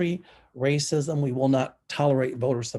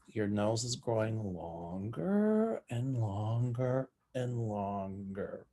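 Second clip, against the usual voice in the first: slow, stretched words, sinking softer; drawn-out teasing; 3 semitones lower, 7 dB quieter, slower than usual. Real, not acted. Teasing Ted about his lying nose, drawn-out and fading. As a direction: draw out each "longer", fading away.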